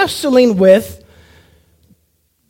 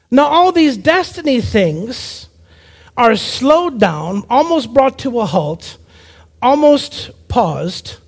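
slowed to a halt; slower than usual. Acted. Preacher acts out things slowing down.

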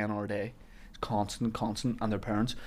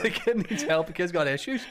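laughing mock; 6 semitones higher, 5 dB louder than usual. Mocking casting director, laughing.

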